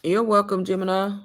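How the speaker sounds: ordinary talk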